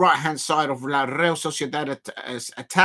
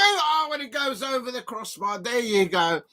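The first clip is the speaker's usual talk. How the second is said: high call easing back to normal; excitement fading; 6 semitones higher than usual. Real, not acted. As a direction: let the excitement drain after a missed chance. Chance goes over the bar.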